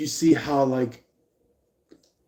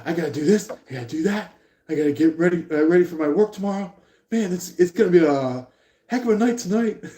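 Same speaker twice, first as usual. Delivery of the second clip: higher, louder, fast frantic listing; frantic rush; 7 semitones higher, 4 dB louder, much faster than usual. Pretend. Sibling scrambling through chores, frantic and fast. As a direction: rattle off the chores in a hurry. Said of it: "they run over and they're like".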